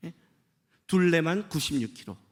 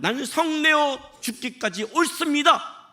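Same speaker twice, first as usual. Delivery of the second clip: defiant Jonah; 9 semitones higher, 4 dB louder, slower than usual. Retold or imitated. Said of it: "이 대답을 할 거예요".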